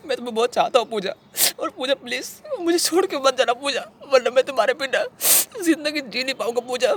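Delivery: high-pitched, crying plea; tearful begging. Acted. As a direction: beg the beloved to stay through tears. Begs beloved not to leave.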